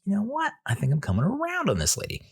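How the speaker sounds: high, quick mock persuasion; mock convinced. Telling the waxer he's convinced, high mock tone. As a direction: play being won over, voice up. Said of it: "was like".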